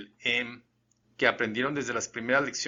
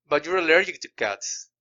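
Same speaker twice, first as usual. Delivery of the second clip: slow, loud and high, weight on "allergic"; emphatic statement; 5 semitones higher, 5 dB louder than usual. Shown as a demonstration. Teacher demos a sure statement, slow and loud. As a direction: say it firmly, as a fact you already know. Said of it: "empiezo con un volumen alto".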